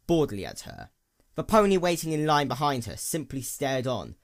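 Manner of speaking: ordinary delivery, nothing marked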